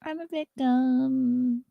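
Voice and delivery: high, slow, mocking sad voice; mock whiny victim. Mocking an influencer, high slow whiny voice. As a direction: whine it out like a pitiful victim.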